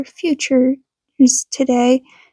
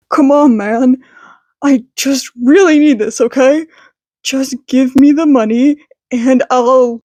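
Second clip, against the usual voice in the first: desperate thug. Acted. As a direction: beg for the money, jittery and fast. Desperate high thug at gunpoint.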